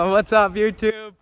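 loud hype intro yell; shouted greeting. Real, not acted. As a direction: shout it out to the viewers.

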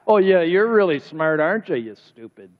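sarcastic mock praise, dropping off at the insult. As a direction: put someone down with fake admiration. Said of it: "Do you think Jesus was sarcastic?".

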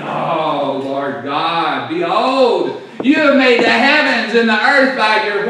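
impassioned cry building to loud proclamation; emotional cry. Shown as a demonstration. Preacher showing how Jeremiah really spoke, emotional cry. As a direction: start heartfelt, swell to a loud declaration. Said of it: "You see how he's speaking here".

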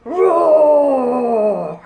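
loud, drawn-out mock dinosaur roar. Showing children how to roar at song's end, playful dino roar. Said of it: "Now say".

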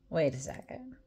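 plain reading voice

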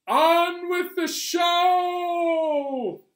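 high, loud, sung out slowly; showman shout. Acted. Librarian singing out the show's start. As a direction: belt it like a ringmaster. Showy announcement.

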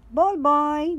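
put-on loud child call; shouting together. A narrator's reading. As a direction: call it out across the court. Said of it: "they shout together".

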